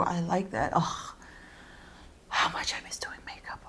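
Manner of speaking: ordinary talk, nothing marked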